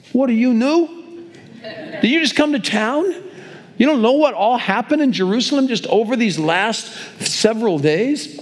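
high, astonished "are you new?"; incredulous. Retold or imitated. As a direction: disbelief that he hasn't heard. Disciple mocks stranger's ignorance.

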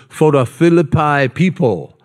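lightly put-on Hawaiian Pidgin; amateur attempt at Hawaiian Pidgin; Hawaiian Pidgin accent. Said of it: "in my best, uh, Pidgin accent".

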